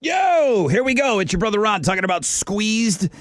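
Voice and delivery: high hyped ad opener. Acted. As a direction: kick off the read with big energy. Hyped ad read opening.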